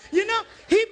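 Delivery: ordinary delivery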